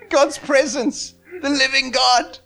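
high-pitched, fired-up preaching; fervent exclamation. Real, not acted. Preacher exclaims over God's presence.

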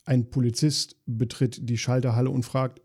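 normal talking voice

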